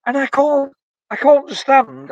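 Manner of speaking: can't believe it, rising in pitch; venting real frustration about the president; real exasperation. Real, not acted. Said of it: "I just get angry when I talk about this".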